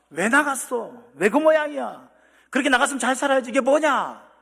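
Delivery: an angry parent scolding the returning son. Pretend. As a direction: berate the runaway son. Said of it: "그렇게 말하지 않았다니까요".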